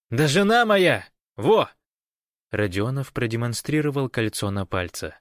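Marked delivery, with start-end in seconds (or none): 0.1-1.7 high, loud exclamation; emphatic insistence to an old friend; excited announcement. A narrator's reading. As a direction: blurt it out proudly. Proudly announces wife to friend.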